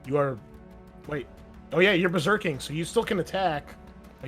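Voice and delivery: ordinary delivery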